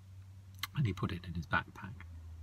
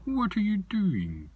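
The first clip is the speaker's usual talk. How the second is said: funny creature voice; 9 semitones higher, 7 dB louder than usual. Acted. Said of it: "And he talked like this".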